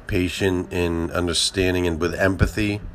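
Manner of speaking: earnest recited prayer. Acted. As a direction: pray it steadily and humbly.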